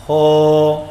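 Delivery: ہو with a smooth clear h and no rasp; clean h. Shown as a demonstration. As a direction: say ہو clean, without grating. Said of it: "اب کوئی خرخرا نہیں ہے".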